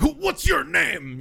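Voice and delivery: high, slow, loud demand; demanding customer. Retold or imitated. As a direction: imitate a caller insisting on a name. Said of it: "when they're like".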